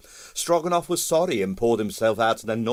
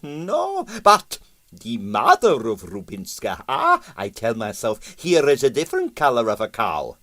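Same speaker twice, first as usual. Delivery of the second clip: put-on higher, Russian-accented, musing aloud and pleased with himself; pompous Russian impresario; 7 semitones higher than usual. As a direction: talk it through like a vain theorist. Stroganoff voiced by narrator.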